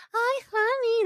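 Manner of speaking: high mock sugary mom voice; sweet gringa mom. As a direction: coo it like a gentle American mom.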